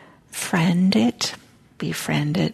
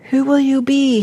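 higher, louder who will you be; shouted question; 8 semitones higher, 6 dB louder than usual. Acted. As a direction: call it after her from the window. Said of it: "he shouted from the half-open window".

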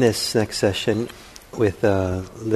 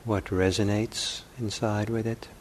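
unhurried meditation voice with long pauses; calm contemplative guiding, phrase by phrase; guided meditation; 7 dB quieter than usual. Acted. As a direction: softly offer questions, leaving silence between them.

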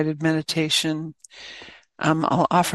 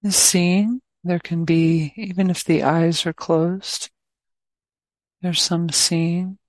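guided meditation. Acted. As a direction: guide listeners gently into awareness, leaving plenty of space.

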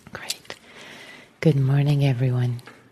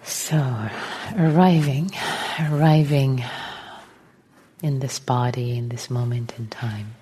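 guided meditation; 3 dB quieter than usual. Real, not acted. Teacher settling the group into meditation, calm and unhurried. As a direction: gently guide them into stillness, phrase by phrase.

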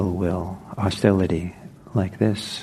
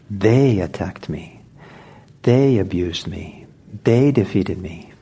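"They" leaned on in each line, said slowly; weight the word They every time; stress on THEY. Shown as a demonstration. Teacher showing the verse's emphasis, They stressed. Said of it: "the emphasis is important".

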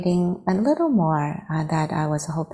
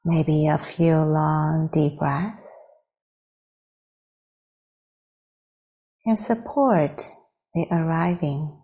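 guided meditation. Real, not acted. Teacher leading friends to arrive in the body, guided meditation. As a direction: guide them unhurriedly into stillness.

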